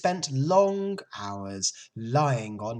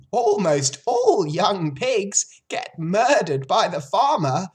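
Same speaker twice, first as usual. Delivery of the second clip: put-on loud, high old sheep spelling out doom; 11 semitones higher, 8 dB louder than usual. A narrator's reading. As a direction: relish the grim news as the old sheep.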